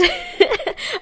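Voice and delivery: high bursting laugh; real laugh. Real, not acted. Recalling her panic, she breaks into a real high laugh. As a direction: laugh out at the memory.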